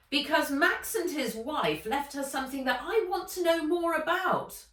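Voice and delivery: put-on a greedy old woman laying her cards down; Ivy, blunt. Ivy's blunt admission.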